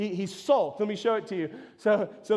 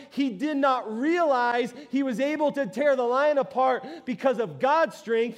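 ordinary delivery

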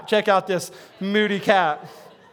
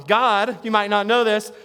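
ordinary talk, nothing marked

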